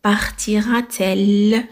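بارتيرا تيل drawn out slowly; teacher dictating the correct question slowly; slow dictation. Shown as a demonstration. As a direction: spell out the inversion for students. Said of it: "ما نقولوش بارتيرا ال".

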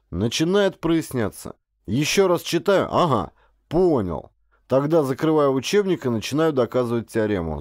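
usual speaking voice

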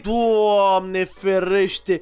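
long drawn-out, stunned exclamation; amazed exclamation. Real, not acted. Gamer finds the other player's hidden entrance, amazed. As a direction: stretch it right out in disbelief.

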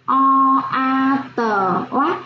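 put-on slow spelling for children; teacher spells oát sound by sound; spelling out syllables. Shown as a demonstration. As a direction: sound out o, a, tờ, then blend into oát. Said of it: "Cô đánh vần".

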